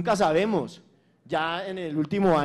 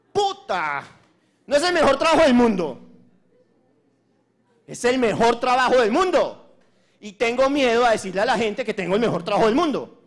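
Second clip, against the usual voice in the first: much higher and louder, thrilled; thrilled shout about her job; excited; 6 semitones higher, 7 dB louder than usual. Real, not acted. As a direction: proclaim it at full excitement. Boasting about the best job.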